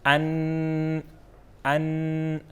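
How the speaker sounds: ان with a light glottal start; hamza sound demo. Shown as a demonstration. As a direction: say ان clean and light. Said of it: "اب حمزے کی پڑھتے ہیں". (ان ہمزہ کی ہلکی آواز میں)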